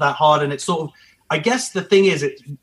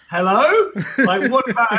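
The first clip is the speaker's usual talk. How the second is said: much higher, playful put-out "Hello?"; mock indignant; 9 semitones higher than usual. Husband imitating his wife ignored after giving birth, mock indignant. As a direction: imitate the wife feeling overlooked, half-laughing. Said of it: "Monique was just like".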